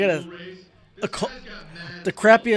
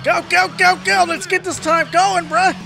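high, rapid-fire cheering on; high-pitched racing hype; excited urging; 8 semitones higher than usual. Real, not acted. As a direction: urge the car forward. Urging the car on.

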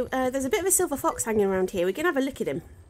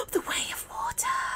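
excited whisper; 5 dB quieter than usual. Real, not acted. Gasps at new music track.

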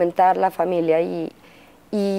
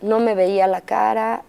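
usual speaking voice

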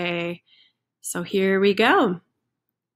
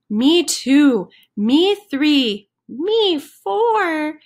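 higher, louder nervous chicken chorus; each chicken chimes in, a bit more scared; echoing scared chickens; 6 semitones higher, 5 dB louder, much slower than usual. Acted.

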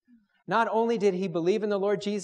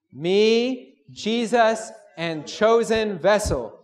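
loud, slow, raised-pitch proclamation; group recitation; 4 semitones higher, 7 dB louder, much slower than usual. Shown as a demonstration. Preacher leads brothers in saying three words together, loud recitation. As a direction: call it out slowly for all to say together. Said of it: "Let's say these three words together".

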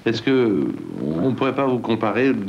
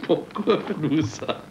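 laughing; 3 semitones higher, 4 dB quieter, slower than usual. Real, not acted. Repeats his question while laughing.

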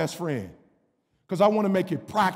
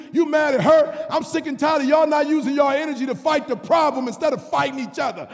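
louder, higher preacher shout; full-force preaching; 7 semitones higher, 4 dB louder than usual. Acted. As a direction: call out the church on their excuses.

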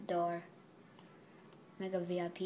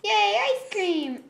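much higher, slow delighted cheer; excited yay; 9 semitones higher, 4 dB louder, slower than usual. Real, not acted. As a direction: squeal with joy over ice cream. Finds ice cream.

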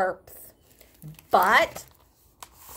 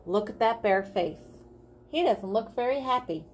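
usual speaking voice

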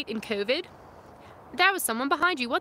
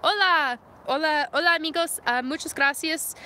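put-on Spanish accent; 3 semitones higher, 4 dB louder than usual. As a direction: switch into a bright-pitched Spanish accent. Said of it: "Let me get into Spanish".

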